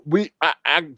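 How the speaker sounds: normal talking voice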